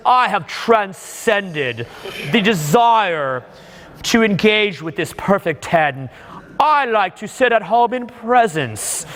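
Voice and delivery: very slow, drawn-out pious voice, mocking a smug guru; mock spiritual snob. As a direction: stretch every word like a self-satisfied enlightened snob. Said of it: "You are such a spiritual fucking snob".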